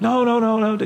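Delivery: high, loud alarmed protest; alarmed crowd. Retold or imitated. Preacher voicing the bus crowd alarmed again. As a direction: cry out to stop the fight. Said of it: "people are like".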